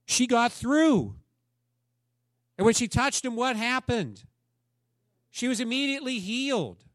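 high and loud, building to triumph; preaching climax. Acted. Pastor telling the congregation of the healing, triumphant and loud. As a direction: lift the room with the victory. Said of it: "preacher rises to climax".

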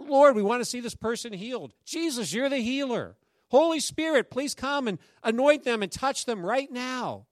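high, loud, pleading prayer; heartfelt prayer. Shown as a demonstration. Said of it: "we're praying with a heart".

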